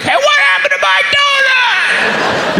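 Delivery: very high, drawn-out shriek; outraged scream. Acted. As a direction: scream it slowly in cartoonish outrage. Painter finds his donut gone.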